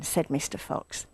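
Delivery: ordinary delivery, nothing marked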